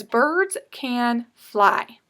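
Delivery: "Birds can fly" slow and clear; slow read-aloud. Shown as a demonstration. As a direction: read it slowly for the class. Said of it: "so this one says".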